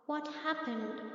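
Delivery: soft, timid question; fearful. A narrator's reading. Old Brahmin meets a crying lion in the jungle, asks fearfully. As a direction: ask quietly, a little scared. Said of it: "the Brahmin asked with fear".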